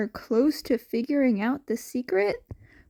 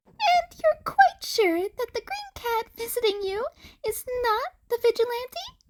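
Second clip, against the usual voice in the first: high, pleasant little creature voice, much higher; a polite, cheery small creature; pleasant rat principal; 12 semitones higher than usual. A narrator's reading. The rat principal greets Aizawa, high and pleasant.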